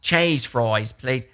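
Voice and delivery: put-on soft Boston accent order. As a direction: say it like a Boston local. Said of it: "oh, wait, that's Boston".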